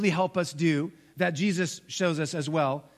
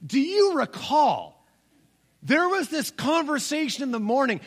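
teasing dad; 8 semitones higher, 4 dB louder than usual. Retold or imitated.

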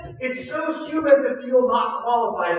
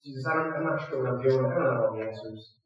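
much lower and softer, humble; quiet prayer; 8 semitones lower, 6 dB quieter than usual. Retold or imitated. Voicing a believer's humble prayer, quiet prayer. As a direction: pray it hushed and surrendered.

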